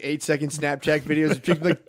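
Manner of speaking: ordinary talk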